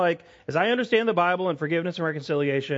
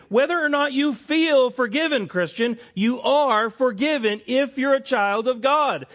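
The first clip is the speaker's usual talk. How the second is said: much higher and louder, pleading with the church; fervent preaching; 9 semitones higher, 5 dB louder than usual. Acted. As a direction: proclaim it to the congregation with full force.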